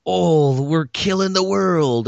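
hammed-up eco-doom wail; preachy mock message. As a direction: slowly moan it like a heavy-handed message movie. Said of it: "it's got a message and".

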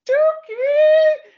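very high, loud drawn-out yell; imitated fan scream. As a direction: scream the name like a lone fan in the crowd. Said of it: "someone's just gonna go".

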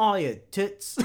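put-on British accent. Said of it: "Wait, no, he's British".

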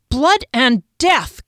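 loud, high, drawn-out curse; startled yell. A narrator's reading. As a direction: yell it in shock, stretching the words. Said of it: "Kier yelled".

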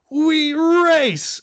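high, loud victory shout; retold yell of a little boy who has just won the race; kid's excited yell. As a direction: throw your arms up and yell it like a thrilled little boy. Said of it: "he's yelling as loud as he can".

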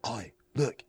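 hushed Australian wildlife-documentary narration; Crocodile Hunter Australian. Acted. Joking about his brother as wildlife, hushed Australian nature-show host. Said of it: "kind of Crocodile Hunter style".

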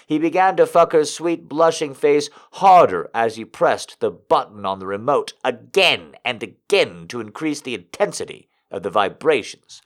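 ordinary delivery

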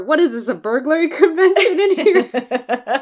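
quoting the line through real laughter; laughing through a quote. Real, not acted. To her friend, retelling the victim's funny last words, laughing.

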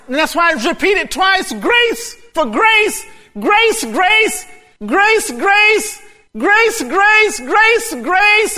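high, loud, driving sermon delivery; full-force preaching. Acted. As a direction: preach it with rising fervor.

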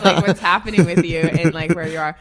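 laughing loudly through the words. Real, not acted. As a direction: talk while laughing hard. Laughing while talking.

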